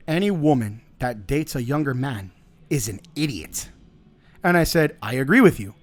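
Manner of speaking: usual speaking voice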